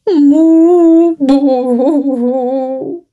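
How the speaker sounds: loud wailing moos and sobs; crying cow. Acted. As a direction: wail like a lost, frightened cow. Lost cow crying in forest.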